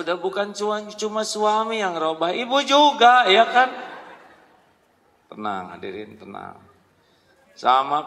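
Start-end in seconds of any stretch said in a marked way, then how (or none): 0.0-3.7 teasing exclamation. Real, not acted. Preacher teases wives loudly.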